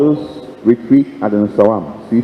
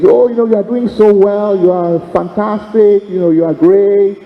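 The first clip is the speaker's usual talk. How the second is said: high, gushing mock flattery; gushing praisers; 10 semitones higher than usual. Well-wishers flattering the weary young priest, high mock gushing. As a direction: lay on the sugary praise.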